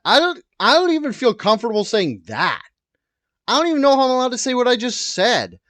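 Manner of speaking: high, loud protest; flustered outburst. Acted. Host unsure what they may say, flustered loud outburst. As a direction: yelp it in rising panic. Mock panic over what was said.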